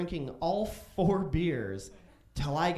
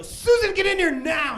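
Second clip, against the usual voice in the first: high, loud shout for his sister; furious yell; 9 dB louder than usual. Retold or imitated. Drunk kid shouting for his sister Susan, high and loud. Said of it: "I go".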